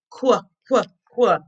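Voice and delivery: "qu" as a short kwuh; Q sound. Shown as a demonstration. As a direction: separate kwuh beats for each "qu". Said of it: "the Q sound is".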